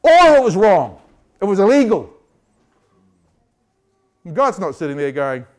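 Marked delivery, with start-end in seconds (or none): 0.0-2.1 loud, emphatic statement; forceful declaration. Real, not acted. Preacher declaring injustice to the congregation, loud. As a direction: proclaim it loudly.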